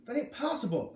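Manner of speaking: high-pitched disbelieving exclamation; exclaiming. A narrator's reading. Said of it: "Curly had exclaimed".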